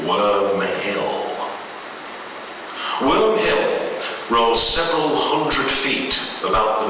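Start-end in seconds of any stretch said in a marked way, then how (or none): none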